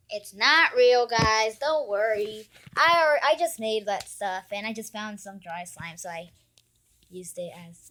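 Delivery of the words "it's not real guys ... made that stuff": louder, eager don't-worry; loud reassurance. Real, not acted. Kid telling viewers not to worry, loudly. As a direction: call out to calm the viewers.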